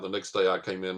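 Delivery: ordinary talk, nothing marked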